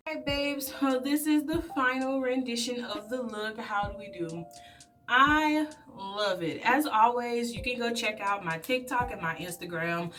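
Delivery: usual speaking voice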